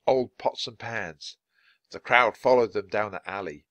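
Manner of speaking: ordinary delivery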